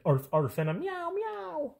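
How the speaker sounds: imitated dog barks and cat meows; bark, then meow high like the pets; cartoonish arf and long high meow; playful pet noises.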